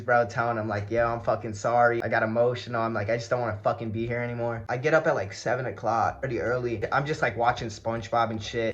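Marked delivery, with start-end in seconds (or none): none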